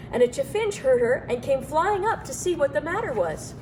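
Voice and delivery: ordinary delivery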